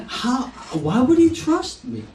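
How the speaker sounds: words spilling through a laugh; amused, half laughing question; laughing while speaking. Real, not acted. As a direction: laugh as you ask it. Joking about patient's trust.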